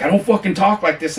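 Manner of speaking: mock an indignant refusal; so pissed. Narrator refusing to read his script, mock pissed. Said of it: "he was so mad".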